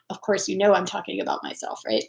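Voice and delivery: confiding whisper under the breath; whispered aside. Real, not acted. To viewers, admitting it's about herself, whispered. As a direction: let them in on a secret, hushed.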